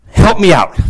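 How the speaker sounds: ordinary talk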